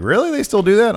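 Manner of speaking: high disbelieving "really?"; incredulous. Retold or imitated. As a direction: act shocked they still exist. Barber's surprised question.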